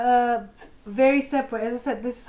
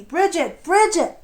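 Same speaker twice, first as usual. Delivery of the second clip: excited recognition; 5 semitones higher, 7 dB louder, slower than usual. Real, not acted. Recognising the goddess card.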